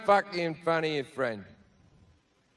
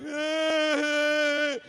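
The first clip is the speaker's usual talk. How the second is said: high mock wail; mock crying wail; 8 semitones higher than usual. Comic imitates the hot girl crying in the bathroom, high mock wail. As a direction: imitate a girl's wail, hammed-up. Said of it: "she's crying like".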